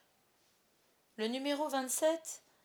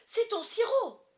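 shocked accusation; 13 semitones higher, 11 dB louder, slower than usual. A narrator's reading. Wife discovers the medicine trick.

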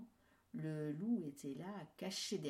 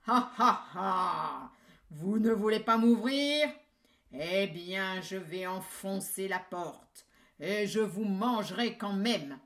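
much louder furious wolf laugh; angry wolf; 2 semitones higher, 11 dB louder than usual. Acted. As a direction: roar threats at the door. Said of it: "le loup se met en colère".

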